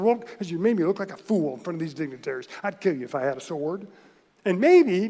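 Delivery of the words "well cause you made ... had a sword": hurried retort. Retold or imitated. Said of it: "Balaam's quick retort".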